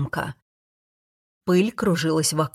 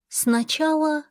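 much higher female voice; prompt goddess; 10 semitones higher, slower than usual. A narrator's reading. Goddess naming her first condition, prompt and high. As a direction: answer at once in a high goddess voice. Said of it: "сказала она без малейшей паузы".

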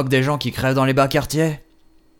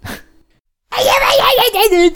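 mock yelps; 5 dB louder, slower than usual. Mock pain cries.

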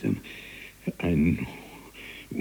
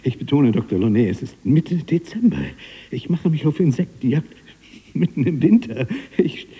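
loud, high, worked-up account; heated, high-pitched protest; agitated insistence; 7 semitones higher, 5 dB louder than usual. Acted. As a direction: insist on it, voice raised in disbelief. Agitated account of the impossible fly.

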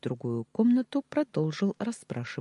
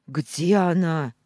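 sudden loud blurt, slow; 3 semitones higher, 8 dB louder, slower than usual. A narrator's reading. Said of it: "выпалил".

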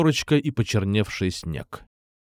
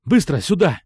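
raised, higher-pitched command; urgent shout; 5 semitones higher, 4 dB louder than usual. A narrator's reading. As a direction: call her over urgently. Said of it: "крикнул я".

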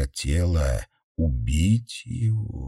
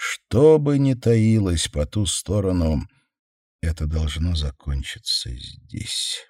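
ordinary delivery

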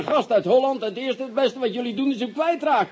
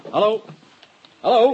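louder raised hallo on a bad line; calling into phone; 7 dB louder than usual. Commissioner calls down a bad phone line, louder. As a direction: call out to reach the caller.

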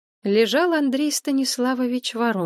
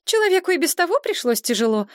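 much higher, outraged friend; indignant Maria; 10 semitones higher, 3 dB louder, faster than usual. A narrator's reading. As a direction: protest in a high, shocked voice. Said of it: "возмутилась Мария". (Мария возмущается, голос намного выше)

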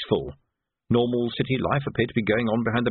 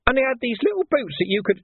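a wheedling gutter lad taking offence; cheeky street urchin Nobby, aggrieved; 13 semitones higher than usual. Acted. Nobby's voice held.

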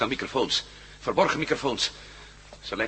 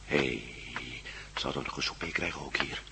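lower, whispered question; 8 semitones lower, 9 dB quieter than usual. Acted. Prisoner whispering to his cellmate about supper. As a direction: whisper it so the guards won't hear.

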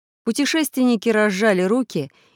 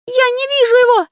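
very high small character voice; high squeaky voice; 8 dB louder than usual. Acted. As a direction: squeak it out in a fluster. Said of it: "ответил карандаш".

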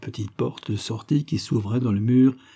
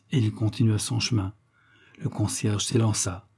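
ordinary delivery

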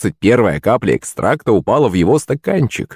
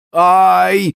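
high drawn-out "ow"; yelp of pain; 9 semitones higher than usual. A narrator's reading. Pupil struck on the forehead, yelp of pain. Boy is hit by a tube.

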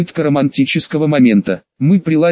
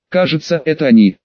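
ordinary delivery, nothing marked

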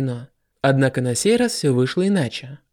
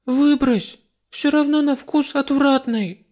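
put-on high, offhand young goddess; dismissive girl voice; 11 semitones higher, slower than usual. A narrator's reading. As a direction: brush it off carelessly. Lista dismisses the coffee.

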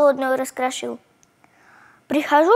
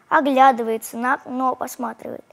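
ordinary delivery

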